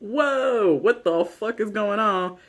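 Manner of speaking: high mock amazement through laughter; laughing, hammed-up bewilderment; imitated shocked reaction. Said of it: "everybody's reactions around".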